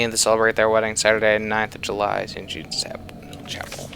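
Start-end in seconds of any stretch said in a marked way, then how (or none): 2.2-3.9 whispering. Real, not acted. Reading the invitation aloud.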